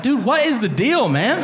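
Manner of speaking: high-pitched, exasperated plea. Retold or imitated. Patient fed up with doctor's jabs, exasperated. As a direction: push it up high in mock exasperation.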